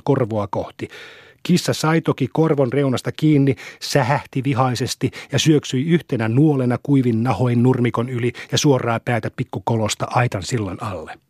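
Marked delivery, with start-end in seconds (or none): none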